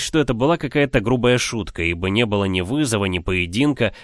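usual speaking voice

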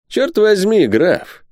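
high-pitched, lively outburst; excited exclamation. A narrator's reading. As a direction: exclaim it to the count. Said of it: "воскликнул он". (радостно восклицая)

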